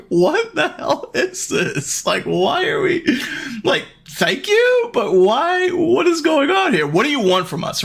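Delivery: high-pitched, stretched-out incredulity; hammed-up bewildered parent, voice way up high; mock bafflement. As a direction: play up the baffled disbelief in a high voice. Parents' baffled reaction, retold.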